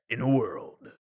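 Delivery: mock trailer announcer; hammed-up trailer narrator; movie trailer voice. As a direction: intone it like a film trailer. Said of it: "always wanted to do the".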